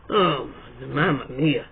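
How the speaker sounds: a weary mock-Italian groan at the absurdity; exasperated sigh. Real, not acted. Reacting to being called an AI, slow and exasperated.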